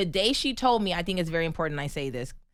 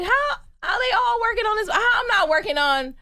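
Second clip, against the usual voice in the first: high, loud mock-outraged protest; indignant outburst; 7 semitones higher, 4 dB louder, faster than usual. Stuntwoman retelling being left off the movie, high-pitched indignation. Acts out past complaint at exclusion.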